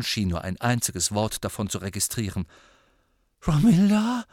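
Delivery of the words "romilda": put-on high, loud lovesick boy; lovesick Ron. A narrator's reading. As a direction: repeat the name high and eager. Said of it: "wiederholte er".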